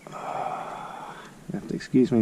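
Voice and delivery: muffled around a torch in mouth; mouth full. Real, not acted. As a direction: talk with something between your teeth. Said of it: "I just had the torch in my mouth".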